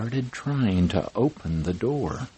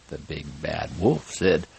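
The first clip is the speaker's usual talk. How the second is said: ordinary delivery, nothing marked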